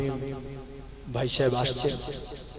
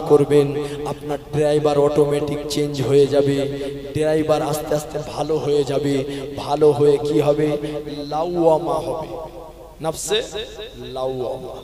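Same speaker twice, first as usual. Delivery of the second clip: rhythmic waz sermon delivery; a Bengali preacher calling out to his listeners; preaching; 5 semitones lower, 8 dB quieter than usual. Acted. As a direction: preach to the gathering, line by line.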